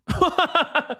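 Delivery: high, helpless laugh; a real surprised laugh; burst of laughter. Real, not acted. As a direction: laugh out in disbelief. Laughing at the reply.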